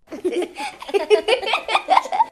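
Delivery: short high giggle; bright little giggle. Real, not acted. Child laughs at end.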